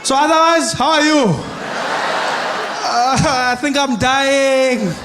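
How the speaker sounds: high, drawn-out distant shouting; calling out. Acted. Security guard and the shot homeowner chatting, calling out loudly. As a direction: holler across a distance, stretching the words.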